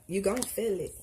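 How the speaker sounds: light, easy country accent. Shown as a demonstration. Reader repeating her promise to Taurus, country accent. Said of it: "In my country accent".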